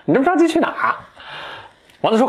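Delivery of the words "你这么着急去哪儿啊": much higher, hammed-up sneering dwarf; cheeky dwarf. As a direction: camp it up, mocking the hurried prince. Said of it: "这侏儒很贱". (尖声怪气、很贱的侏儒腔)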